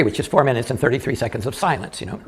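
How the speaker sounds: ordinary delivery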